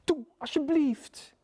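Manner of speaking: high, slow begging voice; wheedling high plea; pleading little creature. A narrator's reading. Little beast begs again.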